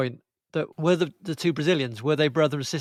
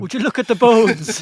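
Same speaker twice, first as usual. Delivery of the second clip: louder quoted line; mock film quote; 5 semitones higher, 9 dB louder than usual. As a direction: call it out like the movie character. Quoting the film's line.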